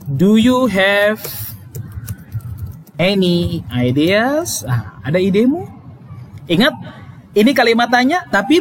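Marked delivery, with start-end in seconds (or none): 0.0-4.6 slow, rising question: Do you have any ideas?; teacher modelling a question, slowly; slow model sentence. Shown as a demonstration. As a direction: ask it slowly, lifting at the end. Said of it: "Kalau question".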